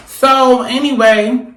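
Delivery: loud drawn-out restart. Real, not acted. Back to camera, loud restart.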